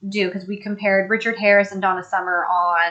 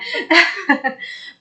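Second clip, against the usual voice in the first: high giggling laugh; real amused giggle; 8 semitones higher than usual. Real, not acted. Laughing during live chat.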